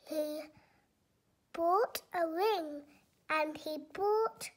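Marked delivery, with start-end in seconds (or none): none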